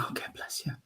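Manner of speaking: soft whisper, barely voiced; whispered aside. Real, not acted. Medium answering the spirit mid-reading, whispered aside. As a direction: whisper it privately, as if to someone unseen.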